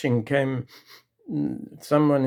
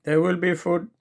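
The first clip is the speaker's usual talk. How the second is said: higher, apologetic wife from kitchen; wife calling from the kitchen; wife's voice; 6 semitones higher than usual. Acted. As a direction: sound eager to please. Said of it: "from the kitchen comes a voice".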